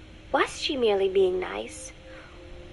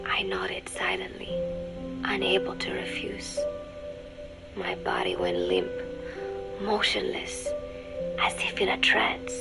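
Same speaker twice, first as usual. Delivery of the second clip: breathy, whispery narration with long pauses; hushed, spellbound; 3 semitones lower, 5 dB quieter than usual. A narrator's reading. Woman recalling being rubbed with lotion, hushed and spellbound. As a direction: drift softly, as if in a trance. Spellbound recollection of the intimate moment.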